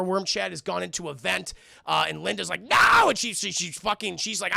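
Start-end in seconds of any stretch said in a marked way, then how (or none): 2.6-3.1 much higher, loud cry; high loud despairing no; desperate shout. Acted. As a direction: scream as the creature gets away. Worm escapes into vent.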